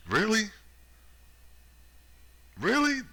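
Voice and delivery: can't believe what he's hearing; incredulous. Real, not acted. Reacting to the official's claim, sharp disbelief.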